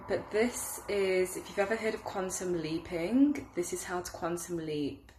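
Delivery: usual speaking voice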